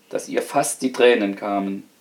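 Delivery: ordinary delivery, nothing marked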